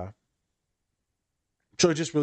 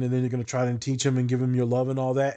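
ordinary talk, nothing marked

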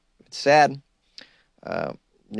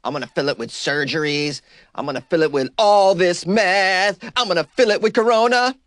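cartoonish junkie voice, rising higher; mock addict voice; 5 semitones higher than usual. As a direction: ham up the craving. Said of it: "We're like".

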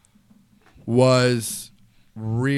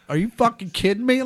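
exasperated; 10 semitones higher, 4 dB louder than usual. Retold or imitated. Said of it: "And I'm like".

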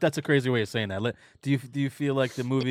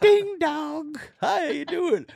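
very high, louder singsong greeting; high-pitched mock greeting; put-on doorstep caller; 5 dB louder, slower than usual. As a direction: play a chirpy visitor at the door. Acts out visitor at the door.

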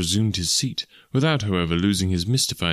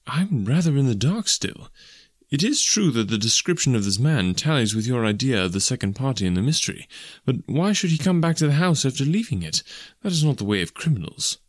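higher-pitched, questioning gentleman; lilting, perplexed voice; puzzled doctor companion; 6 semitones higher than usual. Acted. Doctor asks the detective why the criminal returned, puzzled.

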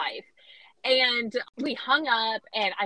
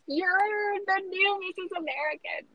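excited announcement; 9 semitones higher than usual. Retold or imitated. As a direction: squeal the big news with joy. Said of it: "They're like".

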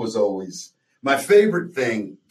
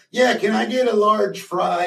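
higher customer voice; 9 semitones higher than usual. Retold or imitated. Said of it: "the guy in the car goes".

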